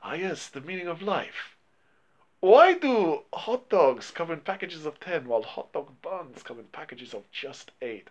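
lightly imitating a Chinese master's accent; mock Chinese accent. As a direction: imitate the sage, unhurried and light. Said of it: "that's how Chow Yun-fat says it".

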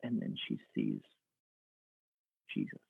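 very soft and low, with a long pause; hushed awe. A narrator's reading. Preacher tells of Mary recognising Jesus, in a hush. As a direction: let the narration drop almost to silence.

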